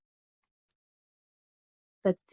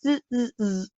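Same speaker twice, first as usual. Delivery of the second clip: monkey chatter; 3 semitones higher than usual. Acted.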